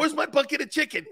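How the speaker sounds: high, quick imitation of a friend; mock boisterous buddy. Buddy demanding his chicken after lifting, high mock whine. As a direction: pitch it high and hurry it.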